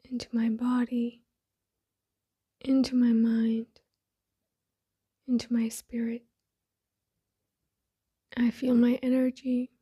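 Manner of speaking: soft, breathy meditation voice with long pauses; hushed, unhurried affirmations spoken as if eyes closed; guided meditation. Acted. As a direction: settle inward, softly, phrase by phrase.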